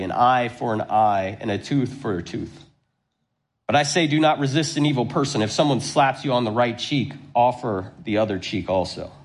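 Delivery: preacher reading scripture, steady and solemn. Acted. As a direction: read the passage aloud to the congregation. Reads the scripture passage.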